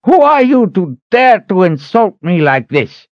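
high, loud outraged shout; indignant, high-pitched fury; angry old man. A narrator's reading. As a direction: explode at the insult in a high character voice. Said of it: "Maban Grid stammered with anger".

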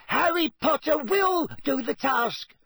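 squeaky, high-pitched insistent elf; Dobby. A narrator's reading. Elf insisting Harry can do the task, squeaking. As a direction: squeak it with utter conviction. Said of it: "squeaked the elf".

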